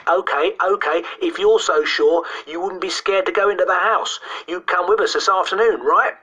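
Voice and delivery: put-on high, loud boy throwing down a dare; challenging schoolboy. Boy daring a doubter into the house, defiant. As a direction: dare them, pitched right up.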